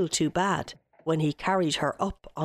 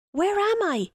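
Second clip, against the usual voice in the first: bewildered Guleesh; 13 semitones higher than usual. Acted.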